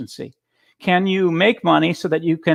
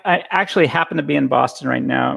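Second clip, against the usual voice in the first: ordinary talk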